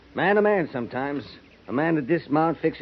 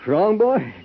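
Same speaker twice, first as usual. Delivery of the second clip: higher and louder, jumpy; sharp startled retort; 10 semitones higher, 6 dB louder than usual. Acted. Soldier caught out by Drury's question, sharply startled. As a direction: bristle defensively at the question.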